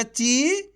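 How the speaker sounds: ची with a long, rising ee; drawn-out vowel. Shown as a demonstration. Teacher shows learners how to read the long ee, drawn-out ची. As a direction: stretch out the vowel of ची. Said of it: "खींच के पढ़ा जाता है".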